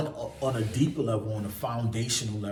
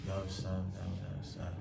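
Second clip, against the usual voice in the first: low mumbled mock greeting; mocking his own low-talking habit; 5 semitones lower, 9 dB quieter than usual. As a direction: swallow the words low. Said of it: "I hate".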